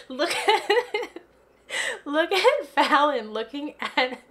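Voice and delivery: laughing while talking, high. Real, not acted. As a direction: crack up mid-sentence. Laughing at Fallon's look.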